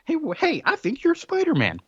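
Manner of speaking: feigned realization. Acted. Peter sets Flash up as the hero, feigned realization. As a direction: act as if it just dawned on you.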